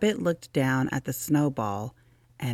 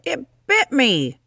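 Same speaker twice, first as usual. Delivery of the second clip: higher, louder shocked cry; startled outburst; 11 semitones higher, 7 dB louder than usual. Acted. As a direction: blurt it out, stung by surprise. Hedgehog shocked by the cold.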